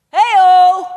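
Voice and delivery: loud high teasing hey-o; playful cheer. Acted. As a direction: whoop it up to tease the crowd. Jokey cheer after saying sex.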